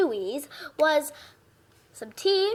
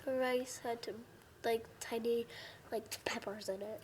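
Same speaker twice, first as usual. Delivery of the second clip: much softer, hesitant voice; quiet admission; 3 semitones lower, 15 dB quieter than usual. Real, not acted. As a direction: confess it softly, in small pieces. Reluctant admission of dislike.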